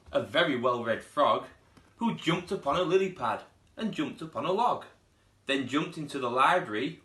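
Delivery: lively read-aloud storytelling voice; storybook narration. A narrator's reading. Teacher reading a picture book to pupils, lively storytelling. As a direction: read it like story time for little ones.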